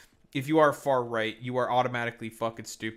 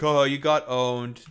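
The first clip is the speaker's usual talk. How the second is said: drawn-out, louder mock taunt; mocking viewers; 2 semitones higher, 7 dB louder, slower than usual. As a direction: imitate gloating fans, stretching it out. Mimicking her viewers' taunts.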